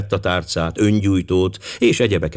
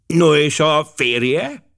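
slow, tentative, higher-pitched question; hesitant; 8 semitones higher, much slower than usual. A narrator's reading. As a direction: ask it haltingly, drawing out the words. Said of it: "kérdezte habozva Forbes tábornok". (habozó, lassú kérdés)

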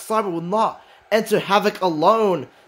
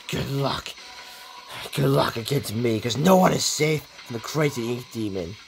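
lower, menacing gloat; villain trash talk; 7 semitones lower, 5 dB quieter than usual. Acted. Bendy taunting Simon after the win, low mock menace. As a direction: taunt the rival like a lurking demon.

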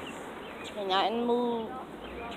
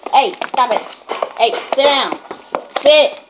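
loud sharp commands, rising higher; loud dog scolding; 3 semitones higher, 7 dB louder than usual. Real, not acted. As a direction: stop the dog cold.

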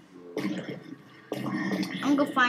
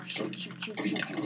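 whispering; 10 dB quieter than usual. Pretend. Tense moment in zombie game.

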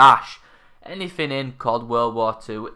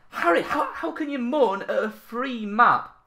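mocking viewer voice; 10 semitones higher than usual. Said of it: "I know what you're saying".

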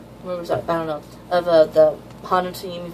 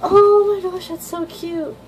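much higher, louder cutesy squeal; gushing squeal; 12 semitones higher, 6 dB louder, slower than usual. Real, not acted. Manga fan gushing over little Mitsuki, high squeal. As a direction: squeal like you can't contain it.

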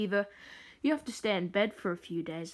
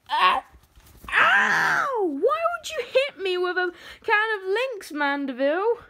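pained shout; 8 semitones higher, 5 dB louder than usual. Acted. Hit with a can of Lynx.

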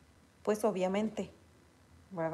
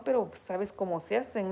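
ordinary delivery

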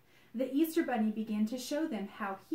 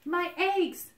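high, loud cry of My eggs; cry of dismay; 8 semitones higher, 6 dB louder than usual. Acted. Bunny sees his painted eggs washed away, crying out high and loud. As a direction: wail it out in alarm. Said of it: "cried the Easter Bunny".

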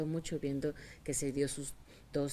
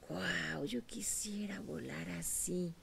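slow, hushed whisper of wonder; awed whisper; 2 semitones higher, 4 dB quieter, much slower than usual. Acted. Eaglet to itself, watching the eagle, awed whisper. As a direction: breathe it out in longing awe.

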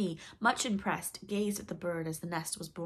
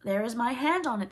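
hearty oath; 5 semitones higher, 7 dB louder than usual. Acted. Pirates swear an oath.

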